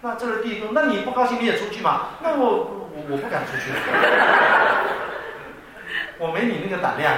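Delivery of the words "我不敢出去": sheepish laugh. Retold or imitated. Elder brother admitting he's too scared to leave, sheepish laughter. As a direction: trail off laughing at your own cowardice.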